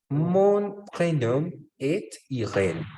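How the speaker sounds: slow model sentence. Shown as a demonstration. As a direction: stretch the sentence out for learners. Teacher models sentence slowly, then repeats. (francés modelo, muy lento y más agudo)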